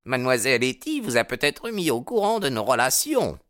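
haughty rival. A narrator's reading. Baldwin to the new boarder about Hetty, haughty. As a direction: taunt him as a jealous suitor.

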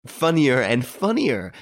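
high, drawn-out amusement; amused. A narrator's reading. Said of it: "the air of being frankly amused".

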